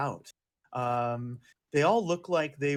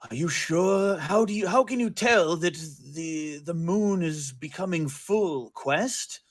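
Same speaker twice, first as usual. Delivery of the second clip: higher-pitched, questioning character voice; overheard character voice; 3 semitones higher, 5 dB louder than usual. Acted. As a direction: put on a higher, doubting voice. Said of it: "you hear this voice say".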